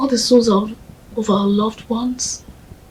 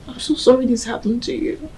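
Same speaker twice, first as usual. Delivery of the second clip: ordinary delivery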